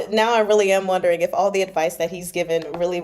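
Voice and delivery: usual speaking voice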